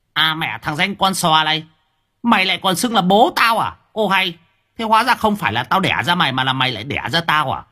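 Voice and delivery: a furious hiss at his son; hissing old man. A narrator's reading. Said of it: "lão rít lên". (lão Hến rít lên giận dữ)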